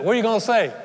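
high, punchy quick question; Jesus. Acted. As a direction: put the question insistently. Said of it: "This is Jesus. He said".

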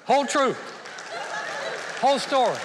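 loud, high, punched out; forceful sermon punchline; emphatic preaching. Real, not acted. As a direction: drive the point home.

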